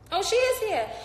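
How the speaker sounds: high, loud burst of surprise; pleasantly surprised. Real, not acted. Host sees awaited guest is here, surprised. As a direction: exclaim as you suddenly spot her.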